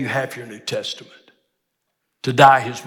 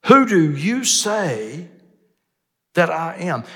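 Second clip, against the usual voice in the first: slow, raised stress on "you"; emphatic question; 5 semitones higher, 4 dB louder, slower than usual. Retold or imitated. Preacher putting Jesus's question to listeners, emphatic. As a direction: stretch and lift "you" as a pointed challenge. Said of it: "It's emphatic in the language of the text".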